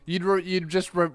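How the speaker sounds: ordinary talk, nothing marked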